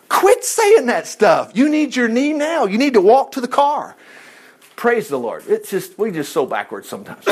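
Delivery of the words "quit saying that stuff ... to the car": high, raised-voice scolding; preacher's high, insistent rebuke; exasperated rebuke. Real, not acted. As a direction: scold them out of their excuses. Rebukes those making excuses.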